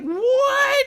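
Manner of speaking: very high squealed disbelief; hammed-up high-pitched outrage; shocked yelp. Character's shocked reply, acted.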